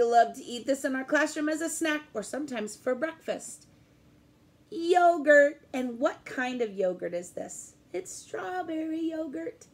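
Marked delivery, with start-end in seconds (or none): 4.7-5.6 bright loud announcing of the word; excited reveal. Shown as a demonstration. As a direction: present it like a treasure to kids. Teacher presents letter Y object.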